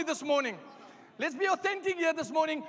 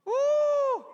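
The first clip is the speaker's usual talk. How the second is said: high shouted woo; loud whoop; 12 semitones higher, 6 dB louder than usual. Real, not acted. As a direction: let out a joyful whoop. Preacher whoops to congregation.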